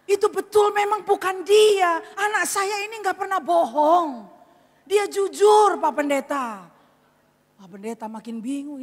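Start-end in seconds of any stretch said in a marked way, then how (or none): 0.1-6.6 loud, high, deliberate insistence; slow, raised-voice protest; emphatic defense. Acted. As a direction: vouch for your child forcefully. Mother firmly defends her son.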